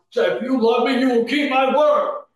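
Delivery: fervent preacher's appeal, pressing each line; preaching at full force. Acted. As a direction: drive it home to the congregation. Preacher raises voice to exhort.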